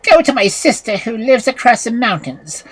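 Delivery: commanding witch. A narrator's reading. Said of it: "called the girl to her and said".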